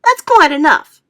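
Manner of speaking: loud, high sharp rebuke; indignant loud snap. A narrator's reading. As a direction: shut it down firmly. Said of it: "the earthworm said sharply".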